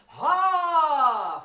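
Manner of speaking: loud high ha from the belly; strong ha. Shown as a demonstration. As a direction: belt out a strong ha. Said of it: "there you go".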